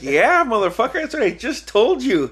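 high, loud mock exasperation; comic indignation, high all through; exasperated retort. Retold exasperated retort about the tattoo.

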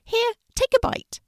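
high, loud little rabbit voice; gentle rabbit calling out to a scared puppy; bright high rabbit, loud. A narrator's reading. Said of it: "he said".

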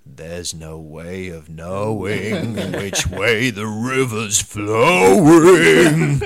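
slow, loud, laughing Manson drawl. Retold or imitated. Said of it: "in a very Manson drawl".

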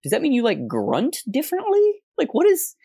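high-pitched incredulous questioning; squeaky, stretched-out mock bafflement; baffled disbelief. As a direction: play up your bewilderment, voice way up high. Acting out baffled reaction to quote.

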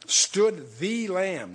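"the" drawn out as "thee", very slow; stress on THE. Shown as a demonstration. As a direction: stretch out "stood the Lamb", leaning hard on "the". Stresses THE against "not a lamb.

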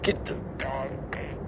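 unvoiced slow whisper; whispered order to get the dog. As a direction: breathe it out slow. Drunk skit, whispered command.

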